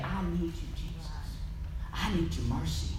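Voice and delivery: whisper-close surrender to Jesus; humble prayer. Retold or imitated. Believer praying to Jesus, soft humble plea. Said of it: "you need to say".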